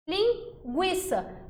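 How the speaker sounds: Linguiça drawn out slowly with the gw sound; slow clear demo. Shown as a demonstration. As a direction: spell out Linguiça as ling-gwi-ça, slowly. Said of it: "o U produz som". (Linguiça bem devagar, com o U pronunciado)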